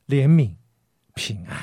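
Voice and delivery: usual speaking voice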